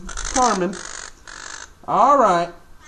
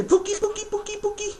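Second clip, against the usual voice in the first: much higher, sing-song Pookie Pookie for the bird to copy; high bird-teaching voice; 13 semitones higher, faster than usual. Shown as a demonstration. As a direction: coax the parrot to repeat it, way up high.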